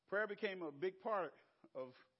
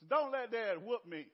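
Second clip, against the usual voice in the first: louder, urgent retold plea; pleading; 4 semitones higher, 7 dB louder than usual. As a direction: beg like a child dreading punishment. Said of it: "I pleaded with God".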